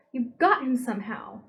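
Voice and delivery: loud accusing shout. A narrator's reading. Meg shouting at the man holding Charles. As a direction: hurl the accusation. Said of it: "Meg shouted".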